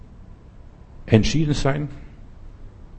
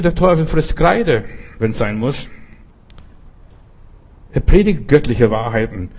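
normal talking voice

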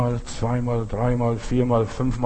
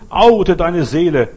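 slow fervent exhortation; 4 semitones higher, 6 dB louder, slower than usual. Acted. Preaching climax.

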